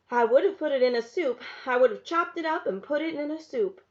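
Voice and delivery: wry, emphatic mother; lightly voiced mother. A narrator's reading. Mother answering her daughter about the snake, wry and emphatic.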